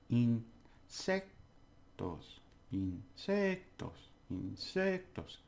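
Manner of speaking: insectos slowly in beats, "sec" drawn out; syllable by syllable. Shown as a demonstration. Teacher drilling the next word, slow syllables with stress. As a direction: separate in, sec, tos, leaning on "sec". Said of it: "you take each syllable".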